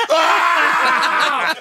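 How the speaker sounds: high, loud startled yelp; mock gasp of shock; shocked shout. Shocked reaction in prayer circle.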